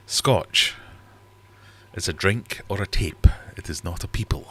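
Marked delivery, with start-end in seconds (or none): 0.1-0.7 Scotch said louder and higher, bristling; indignant stress. Acted. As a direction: spit out Scotch like a trigger word. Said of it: "that's a trigger word".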